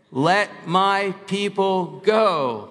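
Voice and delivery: slow, emphatic proclamation; leading a recitation. Shown as a demonstration. Preacher leading congregation in Moses' words, slow proclamation. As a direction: declare it word by word for all to repeat. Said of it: "let's say it".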